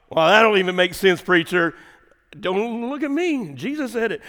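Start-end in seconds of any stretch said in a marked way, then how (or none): 2.4-4.2 higher-pitched, mock-innocent disclaimer; playful protest. Preacher deflects congregation's objection, playfully innocent. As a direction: pass the blame lightly, pitched up.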